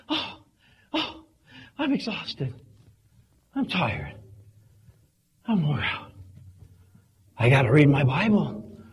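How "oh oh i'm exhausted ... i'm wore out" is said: ordinary talk, nothing marked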